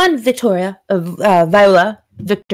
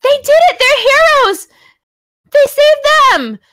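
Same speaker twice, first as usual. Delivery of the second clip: much higher, loud, jubilant cheer; rally the crowd in triumph; Elsa Carver cheering; 5 dB louder than usual. Acted. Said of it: "you hear the voice of Elsa Carver says".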